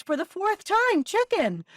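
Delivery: high, loud exasperated snap; fed up, say it for the fourth time. Retold or imitated. Wife to her husband, exasperated and loud. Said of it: "She looks at him exasperated and says".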